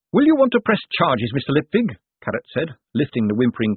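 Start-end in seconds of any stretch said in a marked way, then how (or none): 0.1-2.0 high, eager official question; dutiful watch captain. A narrator's reading. Carrot asks Moist about pressing charges, dutiful. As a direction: pitch it up, polite and earnest.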